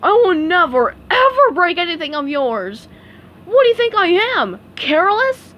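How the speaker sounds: loud, high hurt protest; indignant rant. Acted. Patrick insists to SpongeBob he is careful, loud indignant. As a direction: rant at him, offended. Patrick ranting while breaking stuff.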